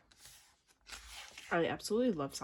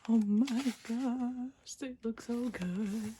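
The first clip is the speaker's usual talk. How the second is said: slow, higher, drawn-out whine; overwhelmed gushing; 4 semitones higher, much slower than usual. Real, not acted. Fan admiring group photos, drawn-out gushing. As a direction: stretch each word in adoration.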